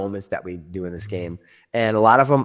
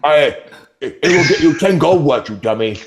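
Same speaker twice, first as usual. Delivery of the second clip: higher, louder ogre scolding; 4 semitones higher, 3 dB louder than usual. Acted. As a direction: snap at the dim brother.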